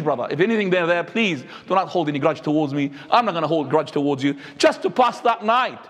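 ordinary talk, nothing marked

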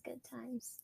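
soft unvoiced whisper. Real, not acted. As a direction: murmur it under the breath. Repeated in a whisper.

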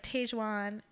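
Tajwan said as TAYZH-wahn; mispronounced name. Shown as a demonstration. Quoting everyone's mistake, Tajwan mispronounced with an AY sound. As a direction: say "Tajwan" with a long A, as in "tay". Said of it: "everyone is like".

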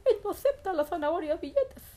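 ordinary delivery, nothing marked

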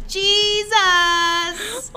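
high squeaky answer through laughter; little boy answering his mother with a grin; grinning child answer. Retold or imitated. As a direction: chirp it out as a cheeky kid, cracking up. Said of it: "And he said".

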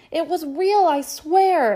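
high, loud pleading insistence. Acted. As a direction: beg them to believe.

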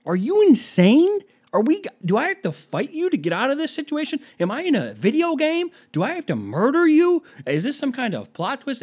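high, loud, incredulous questioning; comic meltdown at a stranger; exasperated rant. Acted.

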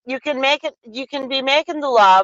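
halting, folksy Wisconsin accent. Shown as a demonstration. Still explaining the law to the co-host, Wisconsin accent. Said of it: "with the Wisconsin accent if you want".

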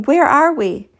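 high, anxious question, lightly winded; panting. A narrator's reading. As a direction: ask urgently after running.

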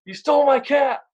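high-pitched mock rage about a stolen cat; mock angry yell. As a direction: play the furious old neighbour accusing him. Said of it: "starts yelling".

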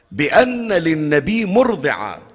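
normal talking voice

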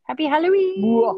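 Happy Halloween squealed high and loud; mad scientist. Acted. Spooky take after plain one.

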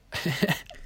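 coughing after swallowing wrong; sudden choking cough. Real, not acted. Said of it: "ich hab mich verschluckt".

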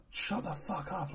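drawn-out, held-back shout out of a window; imitating an annoyed neighbour, softly; mock telling-off. Opening window to tell shouter off.

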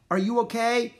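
high, loud question; crying out. A narrator's reading. Annie checking on the crashed musher, crying out. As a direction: call out to check on someone. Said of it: "cried Annie".

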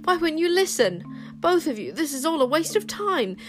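put-on high, urgent girlish pleading; distressed. A narrator's reading. Girl begging both sides to stop fighting, distressed. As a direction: plead desperately to be listened to. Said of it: "cried Wish in distress".

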